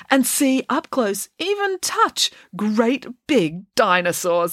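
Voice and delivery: high, slow, gushing; hammed-up enthusiasm. Professor Maynard selling dinosaurs to the students, gushing. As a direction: sell it like a carnival barker.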